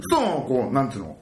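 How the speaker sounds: usual speaking voice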